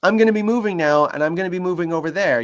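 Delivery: high, loud heads-up to an animal; zookeeper announcement. Shown as a demonstration. Zookeeper telling animal about movement, clear announcing call. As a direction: call out your move like a zookeeper. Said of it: "I picked that up from zookeepers".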